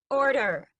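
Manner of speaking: high, loud commanding call; queen calling the mob to order. A narrator's reading. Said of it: "she calls".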